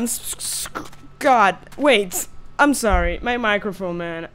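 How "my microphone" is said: ordinary talk, nothing marked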